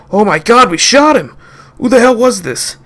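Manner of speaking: high, loud dismay; shocked cry. Acted. Guards seeing the man they shot: high shocked cry. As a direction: cry out in horror.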